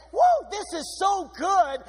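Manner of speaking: high, slow, delighted cry of joy; a touch of hammed-up rapture; excited whoop. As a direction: savour it like dancing for God. David dancing before the Lord.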